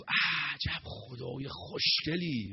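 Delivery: slow, drawn-out mock wonder; stretched-out admiring exclamation; feigned admiration. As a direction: gush slowly over it as if truly impressed. Feigned admiration of the sun god.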